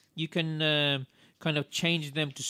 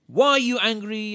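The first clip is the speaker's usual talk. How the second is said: sharp, louder demanding question; harsh; 5 semitones higher, 7 dB louder than usual. Shown as a demonstration. Parent losing temper with child, harsh. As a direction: lose your temper at the child. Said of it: "rather than say".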